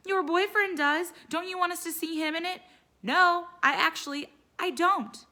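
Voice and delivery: put-on high, loud teenage fury in a fight; hammed-up shouting match; angry argument. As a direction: fire back at Mom, furious. Mother daughter huge fight.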